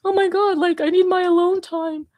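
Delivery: hammed-up scared self about needing space; mock panic. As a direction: act out your own overwhelmed freak-out. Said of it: "I'm like".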